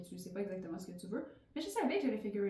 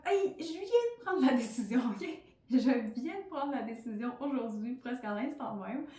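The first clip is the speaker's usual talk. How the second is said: emphatic retort; 2 semitones higher, 5 dB louder than usual. Retold or imitated. Retorting to people's questions.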